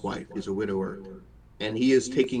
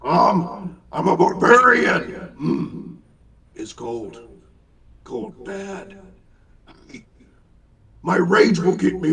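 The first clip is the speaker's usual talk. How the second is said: put-on high, loud, boastful orc teen; rowdy barbarian teen; 5 semitones higher, 5 dB louder, slower than usual. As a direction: brag like a brash young barbarian. GM voicing teen half-orc.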